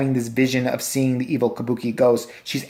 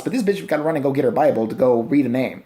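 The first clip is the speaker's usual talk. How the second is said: ordinary talk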